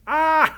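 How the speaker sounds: high, loud howling cackle; hyena laugh. A narrator's reading. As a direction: laugh on and on like a hyena over its prey. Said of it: "laughed and laughed like a hyena howling".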